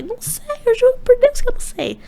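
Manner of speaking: very high-pitched protest; exasperated denial. Retold or imitated. Contestant swearing to nosy people he doesn't know, squealed protest.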